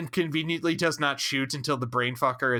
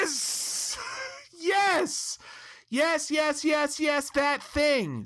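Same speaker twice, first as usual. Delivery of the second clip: excited yes; 12 semitones higher, much slower than usual. Real, not acted. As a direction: pile on the yeses, thrilled. Excited agreement with co-host.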